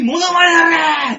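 high, loud, drawn-out yell; re-enacted shout. Re-enacting his repeated order to Ichimura, high drawn-out shout. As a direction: holler it as an exasperated order. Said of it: "って叫んだから".

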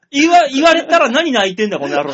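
loud, high-pitched mock berating; retold angry scolding. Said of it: "カチンときてさ".

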